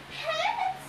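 very high, small questioning squeak; falsetto bride. A narrator's reading. Disguised captive hears of guarding pets, squeaky falsetto.

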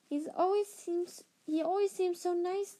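put-on unsure, doubtful girl; uncertain. A narrator's reading. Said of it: "said Hannah un-, uncertainly".

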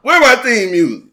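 loud, high playful call; llamando en voz alta. Real, not acted. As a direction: call out for your theme music. Calls out for theme music mid-banter.

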